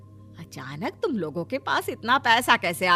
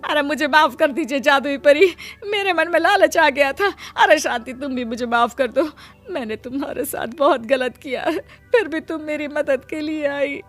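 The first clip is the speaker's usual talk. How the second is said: high, sobbing plea; tearful apology; 5 semitones higher than usual. Acted. Sister-in-law begging fairy and wife for forgiveness, tearful. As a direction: beg forgiveness through tears.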